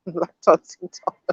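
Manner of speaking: laughing through the punchline; giggling while reading. Real, not acted.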